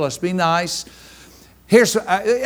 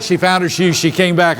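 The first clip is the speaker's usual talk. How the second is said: ordinary talk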